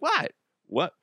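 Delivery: very high feigned surprise; mock innocent what. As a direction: play dumb, squeaky. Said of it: "You're like".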